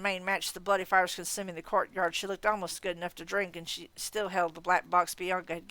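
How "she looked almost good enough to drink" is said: ordinary delivery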